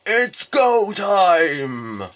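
long, loud, stretched hype yell; drawn-out battle cry. Acted. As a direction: player pumping up for the fight, loud drawn-out call. Hyped battle start call.

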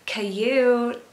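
imitated American Coyote; American accent. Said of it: "she does it in, like, an American accent".

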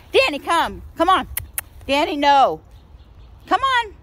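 loud, high call; calling the dog. Real, not acted. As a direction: summon the dog firmly.

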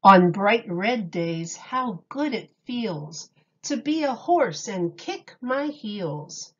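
ordinary delivery

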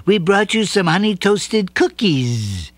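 a hint of mama bee; lightly voiced Mama Nz. A narrator's reading. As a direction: welcome him warmly. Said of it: "said Mama Nz".

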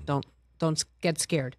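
ordinary talk, nothing marked

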